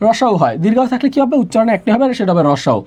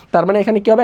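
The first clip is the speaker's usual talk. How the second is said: ordinary talk, nothing marked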